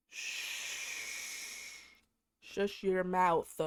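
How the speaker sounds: long hiss, then slow, drawn-out command; exasperated shushing. Retold or imitated. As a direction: shush them slowly and pointedly. Acted shushing of the boyfriend.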